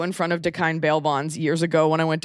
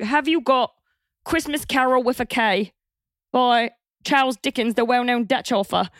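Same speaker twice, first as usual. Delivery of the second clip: higher-pitched Monty Python Cockney; Cockney idiot voice; 6 semitones higher than usual. Retold or imitated. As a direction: play a comic Cockney idiot. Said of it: "there's that idiot voice".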